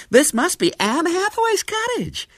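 very high, loud, thrilled lady tourist; ecstatic squealing. A narrator's reading. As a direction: squeal with delight at a great discovery. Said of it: "a little scream of ecstasy".

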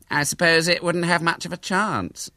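high, sickly-sweet pity; nauseating pity. A narrator's reading. Hubert pities William's cat's chances, nauseating pity. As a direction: ooze false sympathy in a high voice. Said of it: "with nauseating pity in his voice".

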